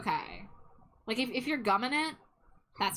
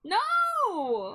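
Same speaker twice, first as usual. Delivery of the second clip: high squeaky character voice; 12 semitones higher, 4 dB louder than usual. Acted. Egg character protests.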